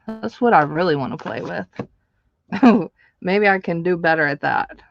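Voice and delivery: normal talking voice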